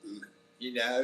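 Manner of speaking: Southern attempt coming out English; Forrest Gump. Streamer fumbling Forrest Gump, accent turning English. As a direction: try Southern American, land on an English accent. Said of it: "An English Forrest Gump".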